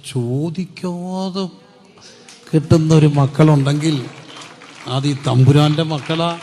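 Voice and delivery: slow then loud proclamation; building preaching climax. Acted. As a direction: rise from hushed slow words to a loud declaration. (പതുക്കെ തുടങ്ങി ഉച്ചത്തിലാകുന്ന പ്രസംഗം)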